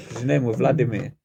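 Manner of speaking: ordinary delivery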